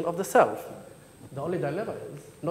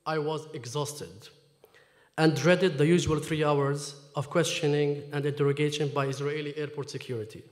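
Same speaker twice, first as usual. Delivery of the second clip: ordinary talk, nothing marked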